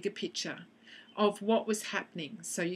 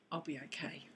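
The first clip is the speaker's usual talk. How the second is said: soft unvoiced whisper; whispered self-reassurance while tapping; 3 semitones lower, 10 dB quieter than usual. Shown as a demonstration. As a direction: breathe the reassurance almost silently. Demo of a calming tapping phrase.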